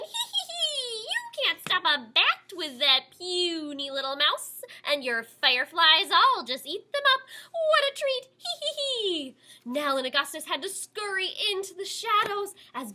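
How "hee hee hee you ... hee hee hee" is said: high, loud cackling bat; squealing bat. A narrator's reading. Bat mocking the heroes, high squealing laugh. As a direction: gloat with shrill giggles. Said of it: "that's squealing".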